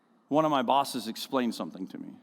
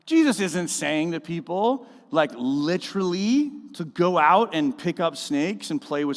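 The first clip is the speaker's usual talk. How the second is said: louder, higher preaching of scripture; 6 semitones higher, 5 dB louder than usual. Acted. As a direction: proclaim it over the church.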